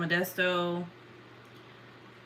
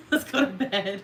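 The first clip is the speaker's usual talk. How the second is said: said through a laugh, pitched up; 4 semitones higher than usual. Real, not acted. Telling viewers it's bedtime, laughing.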